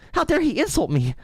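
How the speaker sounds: very high whiny mock voice; mock outrage. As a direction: parody a man offended at being insulted. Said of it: "mocking the caller's outrage".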